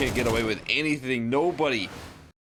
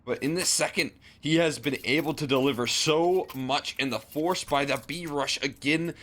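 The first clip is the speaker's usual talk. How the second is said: high, excited co-caster reaction; amazed commentary; 7 semitones higher than usual. Real, not acted. Co-caster hailing Otto saving the day, amazed commentary. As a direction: start astonished, then ease down gradually while staying high.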